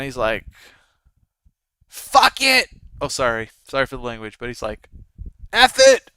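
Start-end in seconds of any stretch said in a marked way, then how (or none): 1.9-2.7 high, loud retold outburst of a kid; frustrated yell. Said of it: "He yells". 5.5-6.1 much louder and higher, mimicking a kid's outburst; frustrated yell. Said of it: "He yells".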